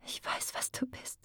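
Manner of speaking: confession whispered almost silently; voiceless, hardly there; barely audible whisper. A narrator's reading. Glenna confessing to Muriel in a barely audible whisper. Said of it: "Sie wisperte das Geständnis kaum hörbar".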